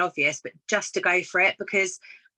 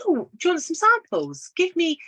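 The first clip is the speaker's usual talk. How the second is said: high, eager sales pitch; eager offer; 10 semitones higher, 3 dB louder than usual. Retold or imitated. Offering samples to the lady in the queue, eager. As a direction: chirp the offer to a stranger.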